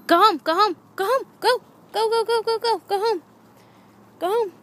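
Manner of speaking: rapid loud high go home; loud command. Real, not acted. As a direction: shout go home over and over. Urging dog home repeatedly.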